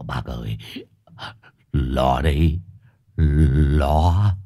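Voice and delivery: low, quavering frightened voice; trembling with fear. A narrator's reading. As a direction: stammer it low, scared of the wife. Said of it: "Long gật đầu run run". (giọng trầm, run run sợ hãi)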